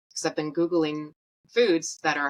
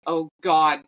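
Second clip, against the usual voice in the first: louder, pleading exclamation; retold exasperation; 7 dB louder than usual. Patient seeing a bag still hanging, loud retold dismay. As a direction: re-enact a dismayed outburst.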